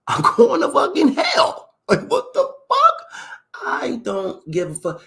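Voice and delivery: high, slow, laughing I-don't-care squeal; mock-reckless laughter about going to hell; laughing defiance.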